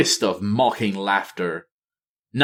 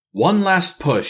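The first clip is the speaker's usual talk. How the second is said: determined queen; 7 semitones higher than usual. Acted. Talanji steels herself.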